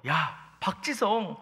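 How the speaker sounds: high excited mock yell; imitated frenzy. Friends calling out Park Ji-sung's goal, imitated excitement. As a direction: act out friends going wild over a goal. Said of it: "친구들이 난리가 났어요".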